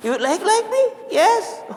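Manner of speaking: high-pitched incredulous retelling; acted astonishment. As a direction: act out a squeaky-high surprised exchange. Acts out astonished exchange with convert. (menirukan kaget dengan nada tinggi)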